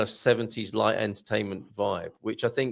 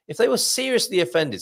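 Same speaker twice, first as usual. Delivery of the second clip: "seriously" read out as if in capitals; higher-pitched emphasis on "seriously"; stress on SERIOUSLY; 6 semitones higher than usual. Retold or imitated. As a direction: lean on the word "seriously". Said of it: "seriously in capitals".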